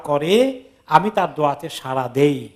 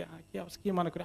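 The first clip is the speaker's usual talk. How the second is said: hushed secret talk; 15 dB quieter, faster than usual. Retold or imitated. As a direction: say it softly so nobody overhears. Minister secretly approaches the visitor.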